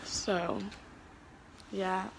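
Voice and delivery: slow, drowsy whisper; tired whisper. Real, not acted. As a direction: breathe it out, worn out. Late night, exhausted.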